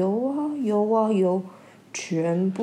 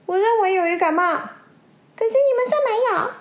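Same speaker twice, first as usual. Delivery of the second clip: much higher, loud and fast; agitated insistence; 11 semitones higher, 5 dB louder, faster than usual. Acted. Grey fish insisting to the others, high fast insistence. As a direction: argue it shrill and quick.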